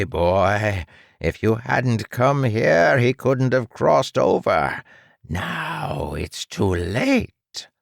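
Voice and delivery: condescending mad scientist, drawn out; smug villain doctor. Acted. Doctor taunts boy.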